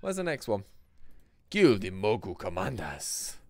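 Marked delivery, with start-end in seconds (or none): 1.5-3.4 put-on Mogu accent. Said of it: "I love their accents".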